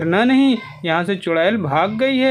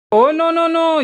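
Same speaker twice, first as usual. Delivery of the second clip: mock dismay; 6 semitones higher, slower than usual. Narrator sees the cow on the roof, high playful dismay. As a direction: act shocked for the kids, higher. Cow climbed onto Barbie house.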